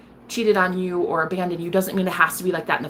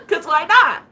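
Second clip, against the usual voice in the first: giggling high and louder; real laughter, higher and louder; laughing; 9 semitones higher, 6 dB louder than usual. Real, not acted. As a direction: laugh through the words.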